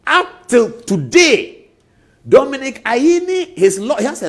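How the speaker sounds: loud, high, slow emphasis on up till today; booming slow emphasis on the name; stressed outcry. Real, not acted. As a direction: drag it out loudly to make the point. Emphatic ranting about lawyer.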